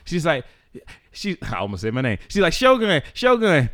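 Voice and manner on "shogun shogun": higher, louder retold cousin calling him out; imitating the cousin demanding backup; mock cousin yelling. Cousin on the phone demanding he back her up, raised mock yell.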